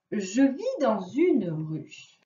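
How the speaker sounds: "Je vis dans une ruche" read very slowly; slow riddle reading. Shown as a demonstration. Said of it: "première devinette". (lecture très lente de la devinette)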